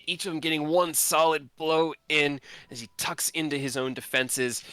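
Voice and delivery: normal talking voice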